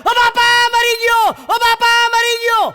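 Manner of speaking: high, loud comic wail; mock yelling in pain. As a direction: howl like a pinched kid on a bike. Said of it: "હું બૂમો પાડું".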